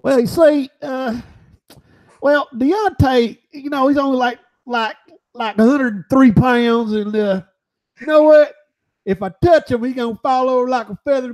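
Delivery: high Southern boxer voice, boasting; Butterbean impression. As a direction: brag like Butterbean about Wilder. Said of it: "What are your thoughts on Deontay Wilder, Butterbean?".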